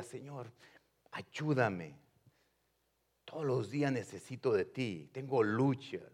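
soft, humble plea to the Lord; hushed prayer. Acted. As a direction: pray it quietly, as if alone with God. Prayer voiced to God.

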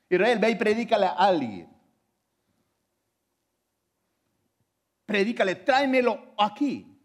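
loud, ringing call to go and preach; rally the congregation like a fiery preacher; fervent preaching. Acted. Pastor urging his congregation to go out, fervent preaching.

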